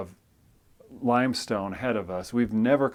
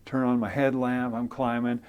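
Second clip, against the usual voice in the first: usual speaking voice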